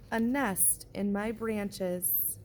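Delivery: usual speaking voice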